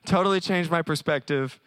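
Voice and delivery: ordinary talk, nothing marked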